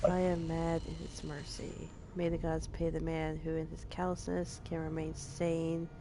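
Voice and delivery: hushed ominous recitation; eerie hushed reading. Acted. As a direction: read it like a dread-soaked prophecy. Reads game's eerie epigraph.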